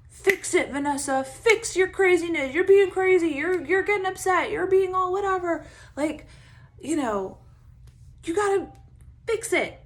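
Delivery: high, loud scolding of herself; harsh inner critic. Retold or imitated. Her inner critic scolding her for getting upset, high and loud. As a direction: snap at yourself like a frantic critic.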